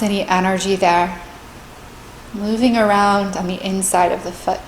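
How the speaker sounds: calm, measured meditation voice with long pauses; guided meditation. Real, not acted. Meditation teacher leading listeners through a body scan, calm and spacious.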